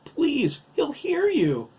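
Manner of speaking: very high, loud begging; frantic, high-pitched warning; hysterical plea. A narrator's reading. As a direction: plead in panic for silence. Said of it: "His tone dipped in hysteria".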